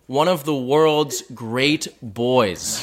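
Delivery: very slow, savoring Boston mom voice, laughing; quoting mom slowly. Retold or imitated. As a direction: drag out each word of mom's praise with a laugh. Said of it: "That's a quote".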